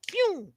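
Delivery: high zipping pew of a bus speeding off; zing it up high like something shooting away; quick high whoosh sound; whoosh noise. Retold or imitated.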